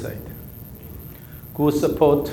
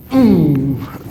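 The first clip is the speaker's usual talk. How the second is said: loud falling "mmm" for the swing; effort hum; 4 dB louder than usual. Shown as a demonstration. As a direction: hum the push of the swing loudly.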